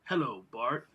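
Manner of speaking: low, soft, menacing greeting; Sideshow Bob catchphrase. Acted. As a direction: purr the greeting like a villain. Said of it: "the famous catchphrase".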